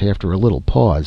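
usual speaking voice

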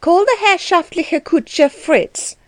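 high, slow, imperious order; mildly stern calling-out, each word deliberate; stern command. A narrator's reading. Frau Dellwig commanding a passing boy, stern command. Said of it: "in a loud and stern voice".

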